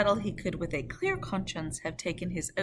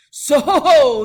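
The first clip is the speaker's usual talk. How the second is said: very loud, higher roar; 9 semitones higher, 18 dB louder than usual. Acted. Guard roaring at the rider, very loud. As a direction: roar it into the dark. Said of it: "as loud as he could roar".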